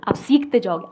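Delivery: usual speaking voice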